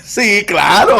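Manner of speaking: loud, high, exclaimed yes; emphatic agreement. Real, not acted. Amused reply after booming prayer demo. (afirmación enfática, alta y aguda)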